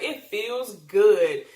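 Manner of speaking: teasing the friend about enjoying food, slow and satisfied; savoring. Retold or imitated. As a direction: stretch it out, content and cheeky. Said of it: "and I was just like".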